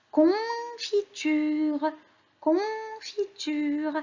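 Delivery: "confiture" high, very slow, "con" held; sounding out. Shown as a demonstration. Teacher names the next picture for children, sounding it out. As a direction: draw "confiture" out syllable by syllable.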